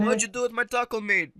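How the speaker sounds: high-pitched mock-Mexican accent. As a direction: put on a bad Mexican accent.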